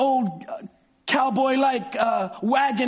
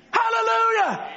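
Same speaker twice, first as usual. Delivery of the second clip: exclaimed hallelujah; 9 semitones higher than usual. Real, not acted. Preacher's exclamation.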